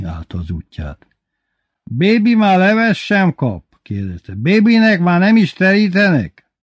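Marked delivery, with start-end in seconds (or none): 1.8-3.8 high, loud indignant demand; loud complaint. A narrator's reading. Bébi asking after his soup, loud complaint. 4.3-6.5 high, loud indignant demand; loud complaint. A narrator's reading. Bébi asking after his soup, loud complaint.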